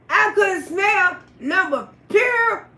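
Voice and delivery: ordinary delivery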